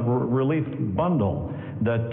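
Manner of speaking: ordinary delivery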